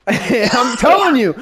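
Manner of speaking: bursting out laughing then insisting; laughing shout. Acted. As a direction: laugh it out, then push the point. Arguing as a pizza customer.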